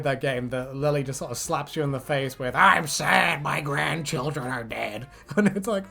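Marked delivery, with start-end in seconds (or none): 2.6-5.1 slow super mutant voice. Acted.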